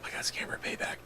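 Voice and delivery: hushed, unvoiced whisper of defeat; stunned whisper of defeat after getting scammed. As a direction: breathe it out in disbelief. Defeated repeat.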